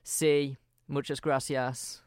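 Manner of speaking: put-on Spanish said in a light Brummie accent; mock a Brit abroad, Birmingham vowels kept, lightly; lightly Brummie Spanish with a slow gracias. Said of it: "I wouldn't drop my accent".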